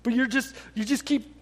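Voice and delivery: normal talking voice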